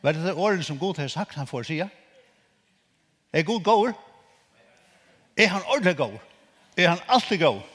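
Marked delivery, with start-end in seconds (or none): 3.3-7.6 high, loud rhetorical questions; fervent call to the crowd; preaching call. Acted. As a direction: rouse the congregation. Preacher asks the congregation.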